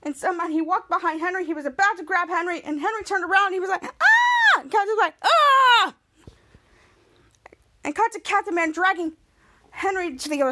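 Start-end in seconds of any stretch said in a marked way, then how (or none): 3.8-4.6 very high, loud "ah"; startled scream. Retold or imitated. Henry turns around to Captain Man, startled scream. 5.2-5.9 high, loud, long "ah"; startled yell. Retold or imitated. Captain Man startled by Henry, loud yell.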